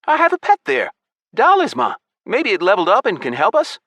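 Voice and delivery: very high, loud character voice; youngster Malik's eager voice. A narrator's reading. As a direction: pipe up high and hopeful.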